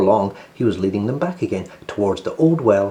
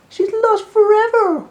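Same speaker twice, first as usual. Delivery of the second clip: very high, slow, loud wail; wailing rabbits; 7 dB louder, slower than usual. Acted. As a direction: brothers grieving their lost sister. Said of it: "wailed the rabbits".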